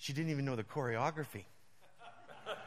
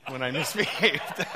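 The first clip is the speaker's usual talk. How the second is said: laughing it out, louder; 10 dB louder than usual. Real, not acted. Preacher laughing about childhood punishment. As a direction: laugh through the word.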